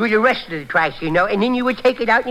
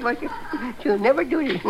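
normal talking voice

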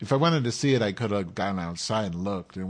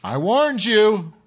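slow, loud, much higher mock warning; ominous warning; 13 semitones higher, 10 dB louder, much slower than usual. Waitress warning him as she walks away, drawn out. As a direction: drag it out as a dire warning. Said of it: "she says".

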